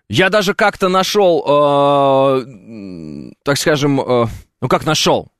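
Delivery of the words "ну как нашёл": usual speaking voice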